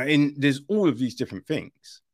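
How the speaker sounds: normal talking voice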